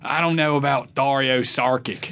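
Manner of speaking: slow, thick mock Philly accent; Philadelphia accent. Philly fan calling sports talk radio, mangling a player's name in Philadelphia accent. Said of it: "Philadelphia accent there, everybody".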